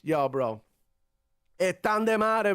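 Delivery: ordinary talk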